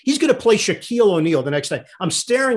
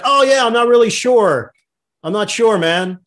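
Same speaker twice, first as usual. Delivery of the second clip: higher, louder feigned casual reply; playing it off; 7 semitones higher, 6 dB louder than usual. To a mystery caller on the phone, playing it off. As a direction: re-enact bluffing along with a caller. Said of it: "I just play it off".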